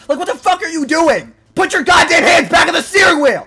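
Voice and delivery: high, loud scolding yell; exasperated shout. Acted. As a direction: yell at a careless driver to shape up. Berating hands-off drivers.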